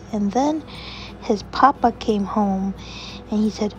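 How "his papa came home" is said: ordinary delivery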